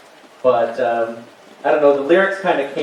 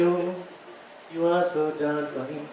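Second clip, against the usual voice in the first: soft echo; 10 dB quieter, slower than usual. Acted. Comedian echoes his own chorus, softly. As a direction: sing it back quietly.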